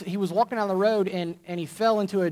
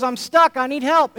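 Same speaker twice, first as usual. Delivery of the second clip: pleading for help; 6 semitones higher, 5 dB louder than usual. Acted. Trapped man pleading.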